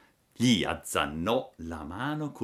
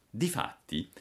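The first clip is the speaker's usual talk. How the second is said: usual speaking voice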